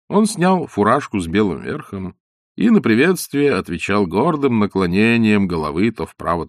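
plain reading voice

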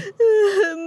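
a high, drawn-out crying wail; sobbing cry; high falsetto sob wail. Acted. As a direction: wail like a girl bursting into tears.

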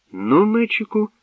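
very high, coaxing call; expectant prompting. A narrator's reading. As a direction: prompt the last suitor teasingly. Princess prompts the last suitor.

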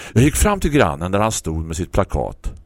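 ordinary delivery

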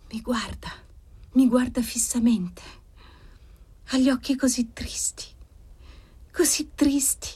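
hushed high trance, rising toward dread; entranced visionary, fading in from afar; faraway voice. A narrator's reading. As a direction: start whispery and remote, climb to a high anguished question. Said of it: "sempre con quella voce che pareva venisse da una profonda lontananza".

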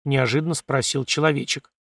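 ordinary delivery, nothing marked

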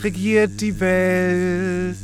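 very slow, airy declaration; slow dreamy voice. Pretend. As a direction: proclaim love slowly and dreamily. Love character speaks slowly.

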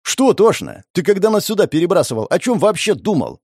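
high, agitated accusation; worked-up quarrelling voice; heated. A narrator's reading. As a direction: fire questions at him hotly. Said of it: "Продолжил горячиться Слава".

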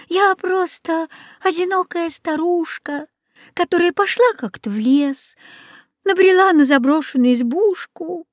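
put-on high singsong old woman; feigned innocence. A narrator's reading. Old woman denies being Baba Yaga, sweetly innocent. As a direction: plead harmlessness in a sweet lilt.

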